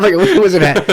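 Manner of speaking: ordinary delivery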